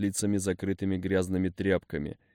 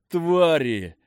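shouted higher curse word; furious curse; 8 semitones higher, 6 dB louder than usual. A narrator's reading. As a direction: hurl the insult in rage. Hero curses captors.

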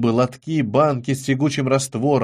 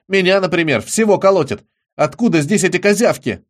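raised, agitated protest; indignant; 5 semitones higher, 5 dB louder than usual. A narrator's reading. Charlie protests to friends about the things in jars, indignant. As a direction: keep protesting, higher and louder. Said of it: "возмутился Чарли".

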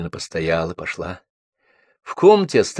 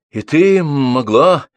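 slow, raised, stunned question; shocked; 6 semitones higher, 5 dB louder, much slower than usual. A narrator's reading. Bibichev learns of Lyolya's affair, shocked. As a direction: draw it out in shocked disbelief. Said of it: "Бибичев потрясён".

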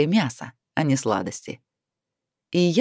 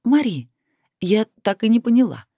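put-on higher-pitched, lively voice of a girlfriend; curious friend; 6 semitones higher than usual. A narrator's reading. Bride asks her friend about the dragon, high and curious. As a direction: pipe up with an eager question, pitch raised. Narrator voices Lanci questioning the heroine.

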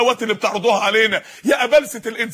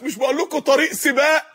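normal talking voice

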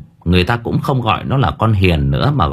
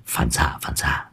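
lower, much softer sheepish excuse; sheepish Thành; 6 semitones lower, 9 dB quieter than usual. Acted. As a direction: mumble the excuse low and quiet. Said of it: "cười hề hề".

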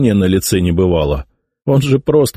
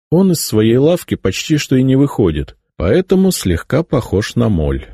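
ordinary delivery, nothing marked

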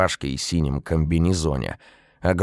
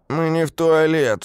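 offended; 12 semitones higher, 4 dB louder than usual. A narrator's reading. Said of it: "встрял оскорблённый Стаська".

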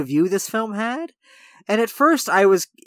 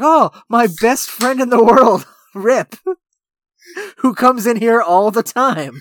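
loud, higher mock-effusive greeting, drawn out; effusive chef; 5 semitones higher, 7 dB louder, slower than usual. Chef greeting his best friend the regular, loudly effusive. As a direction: hail a beloved regular, hammed up. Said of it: "the chef comes out and he's like".

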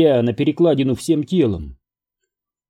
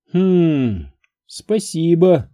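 higher, drawn-out mock-grateful thanks; a higher-voiced hum, then slow thanks; dry thanks; 5 semitones higher, much slower than usual. A narrator's reading. Lucy answers a backhanded compliment, dry thanks. Люси отвечает на сомнительный комплимент.

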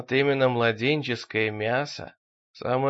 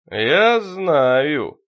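guttural possessed voice; 8 dB louder than usual. A narrator's reading. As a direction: push it up from the belly, not the throat. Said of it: "голос шёл откуда-то из утробы, а не из горла".

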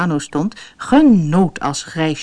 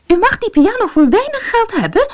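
much higher, hasty sales pitch; quick eager wife; 3 dB louder than usual. Acted. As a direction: rush the offer out. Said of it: "zei mevrouw Dikmans snel".

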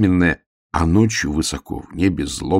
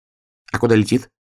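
higher little hedgehog voice; lightly voiced, pitched-up hedgehog; puzzled hedgehog; 5 semitones higher, 3 dB louder than usual. A narrator's reading. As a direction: ask as a puzzled small hedgehog. Hedgehog questions bear in story.

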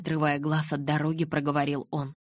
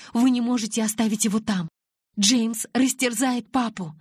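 desperate cry; 7 semitones higher, 6 dB louder than usual. A narrator's reading. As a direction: plead in panic for her father. Bella panics about her father. (отчаянный крик, гораздо выше и громче)

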